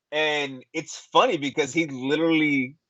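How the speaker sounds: normal talking voice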